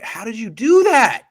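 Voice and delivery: high, loud, drawn-out exclamation; astonished, stretched-out outburst; amazed disbelief. Real, not acted. Amazed reaction to the throw.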